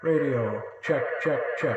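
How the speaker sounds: strong metallic echo; radio check with the echo at three quarters; toy echo mic. Real, not acted. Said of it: "That's three quarters".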